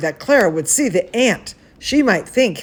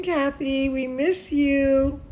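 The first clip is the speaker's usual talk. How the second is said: ordinary talk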